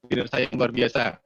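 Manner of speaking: usual speaking voice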